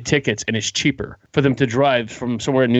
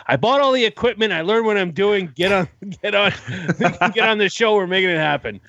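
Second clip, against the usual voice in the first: higher, urgent, laughing through it; excited pushy friend; 6 semitones higher than usual. Retold or imitated. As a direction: push him to get on the show, mock eager. Rob's excited push to start.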